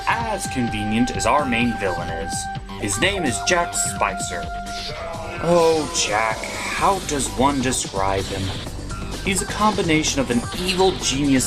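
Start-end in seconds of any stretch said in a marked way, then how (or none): none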